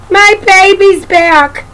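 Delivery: high, loud, overjoyed storybook mama; joyful mother welcoming her baby; happy mama. A narrator's reading. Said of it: "says her mama".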